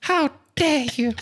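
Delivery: very high, put-on activist voice; Greta Thunberg. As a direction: repeat the reproach as a squeaky-high impersonation. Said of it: "is actually from Greta Thunberg".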